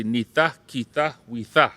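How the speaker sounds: nitha, kitha, witha with clear th; Cree word series. Shown as a demonstration. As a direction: chant the th forms for the class. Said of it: "To say".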